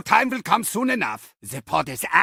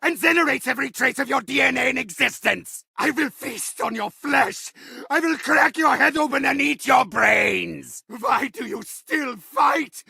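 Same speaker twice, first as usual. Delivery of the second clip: higher, shouting villain rage, spitting every threat; furious ranting villain; 3 semitones higher than usual. Acted. Villain raging at players who keep surviving, furious shouting. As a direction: scream threats at the worms who won't die.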